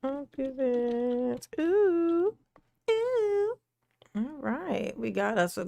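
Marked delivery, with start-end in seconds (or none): 1.6-3.5 high delighted ooh; high excited cooing. Real, not acted. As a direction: coo with delight.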